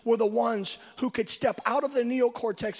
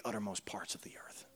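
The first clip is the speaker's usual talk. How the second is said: softer, lower whisper; whispered ending; 12 semitones lower, 13 dB quieter than usual. Real, not acted. Preacher whispering to the congregation about being blown away. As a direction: breathe the line out in a whisper.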